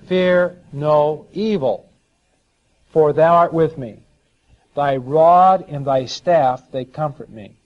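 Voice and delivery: slow, weighty scripture delivery, falling away at the end; psalm recitation. Acted. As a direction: proclaim each phrase slowly and firmly.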